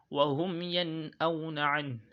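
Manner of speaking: slow recited "Wa hum yen auna an", clear n moving on into "auna"; nun without emphasis. Shown as a demonstration. Teacher modelling idhar, unemphasised n in "yen auna". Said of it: "start without laying emphasis".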